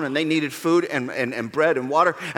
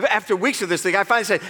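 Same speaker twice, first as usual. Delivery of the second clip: ordinary talk, nothing marked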